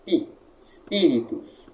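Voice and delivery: pi alone, then espíritos stressed on PI; espíritos with the PI syllable strongest; stressed syllable demo. Shown as a demonstration. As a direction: isolate pi, then lean on it in espíritos. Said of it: "a tônica anterior".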